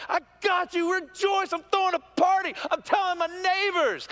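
high, loud exultant cry; joyful God. Acted. God celebrating finding the lost person, high exultant joy. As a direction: shout it like throwing a party. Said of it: "That's how God acted".

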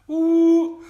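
high, loud excited whoop. Acted. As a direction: let out a hype whoop. Hyping the party edition.

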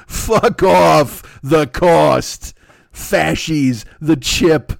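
loud scornful retort; loud dismissal. Real, not acted. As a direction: shout it away. Angry dismissal.

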